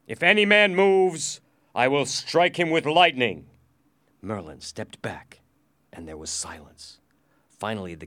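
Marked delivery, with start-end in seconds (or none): 0.1-3.5 loud, high warning, easing slightly; commanding threat. Acted. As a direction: threaten them like a sorcerer. Prisoner threatens with magic powers.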